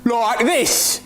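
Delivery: high-pitched imitation of an Australian; mock Australian accent. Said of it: "are you always going to sound like that".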